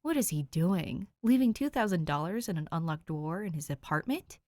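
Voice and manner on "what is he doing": ordinary delivery, nothing marked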